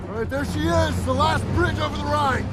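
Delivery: high, loud battlefield call; shouting over noise. Acted. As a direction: shout above the gunfire.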